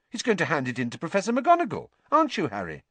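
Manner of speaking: high, prim disbelief; scoffing at a ludicrous idea. A narrator's reading. Hermione dismissing the idea to Ron, primly scoffing. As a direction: treat the idea as absurd. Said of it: "as though the idea was ludicrous".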